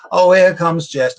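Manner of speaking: a teasing fanfare for Jess; mock grand announcement. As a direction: announce him with a mock flourish. Announcing his grand entrance.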